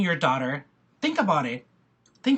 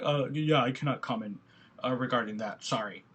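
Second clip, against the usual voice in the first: lightly imitated officer, lower and softer; nervous officer; 6 semitones lower, 5 dB quieter than usual. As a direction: back off the question like an uneasy cop.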